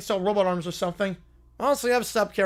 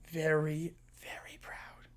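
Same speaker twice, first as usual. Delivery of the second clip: fading whisper; whispered echo; 6 semitones lower, 12 dB quieter, slower than usual. Acted. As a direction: let it trail off into a whisper.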